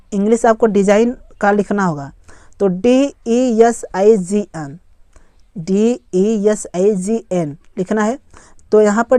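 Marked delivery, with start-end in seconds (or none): 2.7-4.8 slow letter-by-letter spelling; spaced-out letters. Shown as a demonstration. Spelling a word aloud for learners. 5.6-7.6 slow letter-by-letter spelling; spaced-out letters. Shown as a demonstration. Spelling a word aloud for learners.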